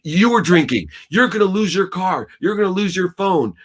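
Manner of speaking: father imagining scolding his daughter, mock furious; mock furious rant. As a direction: pile on the threats like an angry dad. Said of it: "I would have called her in ego, in fear".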